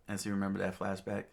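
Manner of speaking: ordinary delivery, nothing marked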